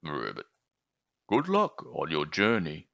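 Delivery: low frog croak. Acted. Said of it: "croaked a farewell".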